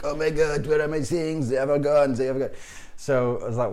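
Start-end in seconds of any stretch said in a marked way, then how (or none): 0.0-2.5 panicked Frenchman, high and loud; French friend's accent. Retold or imitated. French friend discovers his belongings are gone, agitated French accent. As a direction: put on a thick, fretting French accent. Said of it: "I had a French friend called Antoine".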